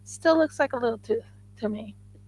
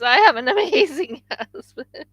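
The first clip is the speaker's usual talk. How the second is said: laughing; 5 semitones higher, 5 dB louder than usual. Real, not acted. Repeats with laugh, higher.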